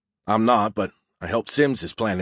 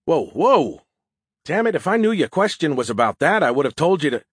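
higher, emphatic character voice; lightly voiced Skippy, taken aback; 5 semitones higher, 4 dB louder than usual. Skippy backpedals to Joe, higher and taken aback. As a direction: protest in a higher, lively voice.

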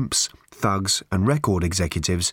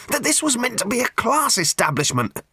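very high strained protest; gasped; 13 semitones higher than usual. A narrator's reading. Ford protesting about the bar, high pained gasp. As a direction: gasp it out far above the normal voice. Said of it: "gasped Ford".

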